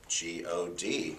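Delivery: slow, soft, nervous spelling of each letter; a timid guy sounding out the letters. Retold or imitated. Businessman at heaven's gate, nervously spelling. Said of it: "kind of nervous".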